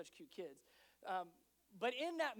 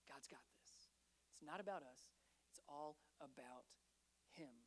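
whispered, much softer; hushed reassurance; 6 semitones lower, 11 dB quieter than usual. Acted. Gideon calming his men, hushed whisper. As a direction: breathe it like a secret assurance.

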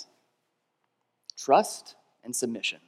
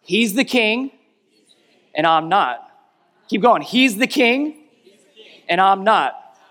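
higher, louder chant of He's the king, and I'm not; rousing call-and-response; 6 semitones higher, 6 dB louder, slower than usual. Shown as a demonstration. Pastor rallying the congregation to repeat. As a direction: call it out like you mean it. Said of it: "One more time, like you mean it".

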